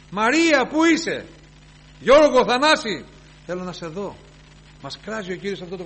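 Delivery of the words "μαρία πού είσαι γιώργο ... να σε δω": loud, high calling of names. Retold or imitated. Lord calling believers by name, loud calling. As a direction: call each name across a crowd. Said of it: "τη φωνάζει και της λέει".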